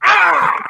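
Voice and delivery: high startled yelp. Retold or imitated. Dad startled at being called Jake's dad, high yelp. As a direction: yelp in mock shock. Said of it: "I'm like".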